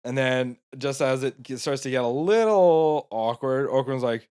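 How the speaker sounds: ordinary delivery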